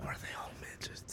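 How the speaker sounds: whispered question, very soft; hushed whisper. Real, not acted. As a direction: ask it under your breath. Hushed question on an awkward word.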